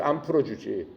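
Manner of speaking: ordinary delivery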